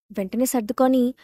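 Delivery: plain reading voice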